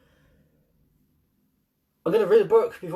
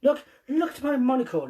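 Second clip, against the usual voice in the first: posh toff voice; 9 semitones higher than usual. Pretend. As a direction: show off the monocle like a toff. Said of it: "Let me get my monocle".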